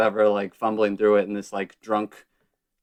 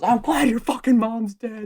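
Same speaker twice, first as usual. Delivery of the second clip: retold yell; 12 semitones higher than usual. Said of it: "And my friend goes".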